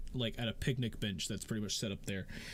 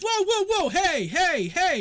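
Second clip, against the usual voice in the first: loud high call to break it up; shouting to stop a fight; 11 dB louder, slower than usual. Acted. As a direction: yell over the brawl, whoa and hey. Joseph breaks up a brawl.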